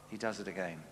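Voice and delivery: ordinary talk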